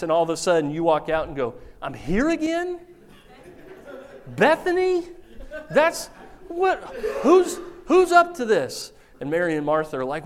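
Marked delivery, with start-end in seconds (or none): 1.8-8.9 high, laughing indignant voice; grumpy Lazarus. Acted. Lazarus back from paradise, laughing indignant complaint. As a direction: complain comically at being back. Lazarus annoyed at being raised.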